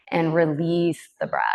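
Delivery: calm, unhurried yoga teacher voice with long pauses; even, measured breath-awareness cueing; guided meditation. Real, not acted. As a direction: gently guide them into the breath.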